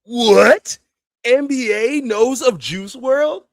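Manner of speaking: can't believe what he just heard; stunned disbelief. Real, not acted. Streamer shocked NBA knows Juice.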